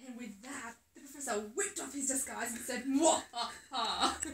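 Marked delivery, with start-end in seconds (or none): 2.8-4.3 loud hammed-up evil cackle; disguised bunny cackling his reveal; villain laugh. A narrator's reading. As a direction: cackle like a pantomime baddie.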